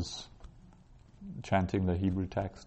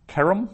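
Kerem with KE stressed, high and loud; stress on first syllable; 11 semitones higher, 5 dB louder than usual. Shown as a demonstration. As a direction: punch the KE of Kerem. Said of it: "that's accenting the ke".

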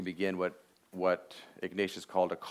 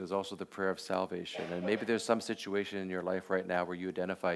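ordinary talk, nothing marked

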